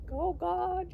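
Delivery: high pleading cry to God; frantic prayer. Retold or imitated. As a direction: imitate grandma's panicked praying. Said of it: "praying to Heavenly Father".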